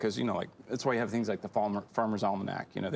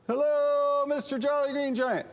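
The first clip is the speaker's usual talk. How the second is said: high, loud drawn-out hello to a giant; high-pitched hail from far below; rabbi calling up; 4 dB louder, slower than usual. Acted. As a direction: holler upward in a very high sustained voice. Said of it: "and he says".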